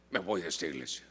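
very low, quiet sulk; mock sulking. Corrected church member threatens to leave, low mock sulk. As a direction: mutter it low, as if offended.

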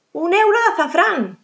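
high shouted question. Acted. Garbancito shouting up to the shopkeeper, high call. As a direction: call it up from the floor. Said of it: "se puso a gritar".

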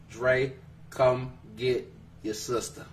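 slow, each word set apart; emphatic word-by-word. Pretend. Host telling brother to come get his sister, slowly insistent. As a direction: spell out the demand one word at a time.

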